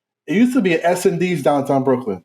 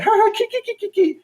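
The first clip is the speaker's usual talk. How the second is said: high, silly fake giggle; mock giggling. Imitating the girls giggling at the brother.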